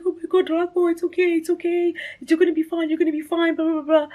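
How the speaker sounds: very high, fretful reassurance; mock-tearful comforting in a high voice; imitated crying sister. Sister comforting her on the stretcher, high imitated tearful fluster. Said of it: "and she's crying".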